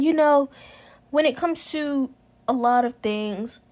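ordinary talk

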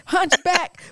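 high, loud trash-talking; mock roast. Friend roasting an ex, loud and high. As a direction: insult the ex's body, laughing.

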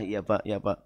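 very soft, low yes-sir; cowed employee answers his angry boss meekly; meek reply. Acted. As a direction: mumble timidly to the boss.

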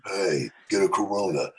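low, slow action-star voice; deep Stallone-like Diesel. Retold or imitated. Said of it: "Sylvester Stallone kind of deep".

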